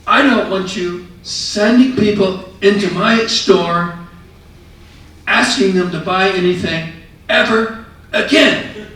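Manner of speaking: loud, high angry shouting, slow; furious yell. Retold or imitated. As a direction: bellow it as an enraged store owner. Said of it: "he was just furious".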